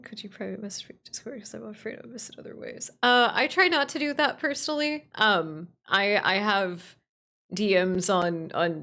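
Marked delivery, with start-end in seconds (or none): 0.0-2.9 soft rushed whisper. Real, not acted. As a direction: read it under the breath. Reading chat request softly.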